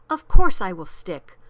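loud burst of conviction; hearty, loud assurance; emphatic promise. A narrator's reading. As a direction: pledge it forcefully. Horton eagerly promises his help.

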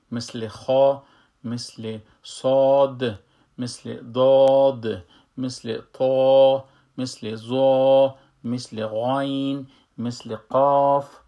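each heavy letter said slow, loud and full in the mouth; teacher drills the heavy letters, slow and loud. Shown as a demonstration. Teacher drills the heavy Arabic letters for students, slow, loud and full.

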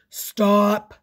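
loud booming yell. Acted. Giant father stopping the traffic, loud yell. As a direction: bellow it at the cars. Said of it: "and yelled".

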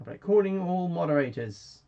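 high, loud call for help; mock alarm. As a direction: raise the alarm, hammed up.

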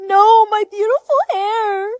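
high, teary hammed-up lament; mock wail. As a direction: wail over the lost hair. Mourning cut hair.

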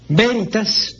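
"veritas" with a Spanish B sound, stress on the first syllable, BÉ-ri-tas; traditional Spanish veritas. Shown as a demonstration. Language teacher showing a listener the Spanish reading. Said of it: "se puede pronunciar en latín de dos maneras".

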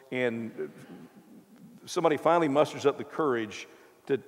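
ordinary talk, nothing marked

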